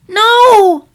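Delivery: high loud desperate "No!"; horrified cry. Acted. Filly watches her teacher pulled under water, high horrified shout. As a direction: scream as she goes under.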